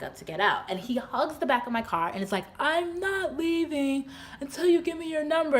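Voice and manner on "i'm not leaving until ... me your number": high-pitched mock pleading voice; insistent suitor. Man hugging her car, mock high-pitched insistence. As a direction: imitate a stubborn man, pitched way up.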